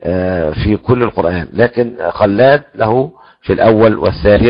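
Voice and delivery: ordinary delivery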